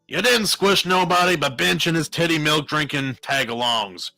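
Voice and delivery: scornful retort. A narrator's reading. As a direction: throw the insult back at them. Hardy's scornful retort to Hess.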